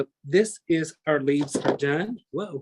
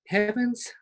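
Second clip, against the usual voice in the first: startled exclamation; 8 semitones higher than usual. Real, not acted. Suddenly remembers a forgotten step.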